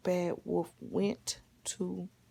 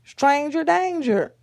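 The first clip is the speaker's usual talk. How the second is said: loud, raised-pitch slogan call; warning call; 7 semitones higher, 8 dB louder than usual. Shown as a demonstration. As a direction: model the warning for a child. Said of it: "what Mama told you to say".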